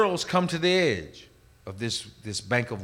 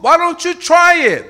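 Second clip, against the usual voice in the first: high, loud, stretched-out invitation; imitating strong men; 8 dB louder than usual. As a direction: lure them in with a loud call. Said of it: "their voice sounded like grown, strong men".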